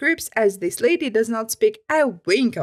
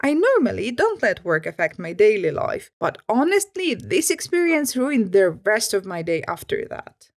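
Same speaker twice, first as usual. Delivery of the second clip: plain reading voice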